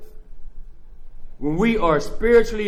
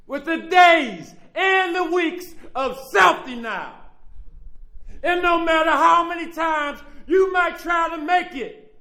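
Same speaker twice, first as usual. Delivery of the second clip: preaching at full force; 9 semitones higher than usual. Real, not acted. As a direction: preach it out to the whole room. Preaching his testimony at full force.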